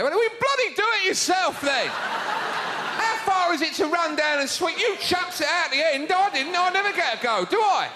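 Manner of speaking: high, loud, whining rant of a fed-up curler; mock tantrum. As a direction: yell like the sweeper finally snapping. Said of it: "One of 'em will flip out one day".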